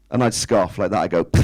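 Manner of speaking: normal talking voice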